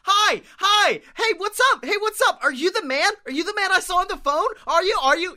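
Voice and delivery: very high, eager rushed chirp; sheltered wide-eyed character meeting a stranger; Kimmy Schmidt. Acted. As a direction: gush like someone who's never left home. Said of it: "it's like, uh, Kimmy Schmidt".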